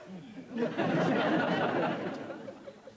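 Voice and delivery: high gloating laugh; triumphant laugh. Acted.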